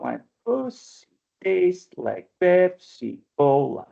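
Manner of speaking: "my pussy tastes like Pepsi Cola" slowly, with gaps between beats; syllable-by-syllable scansion. Shown as a demonstration. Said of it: "let's take it apart".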